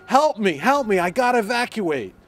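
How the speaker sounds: loud, high-pitched cry for help; imitated screaming. Resident imitating neighbor trapped by the flood, screaming for help. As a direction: yell it like the panicked neighbor. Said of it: "he's screaming at the top".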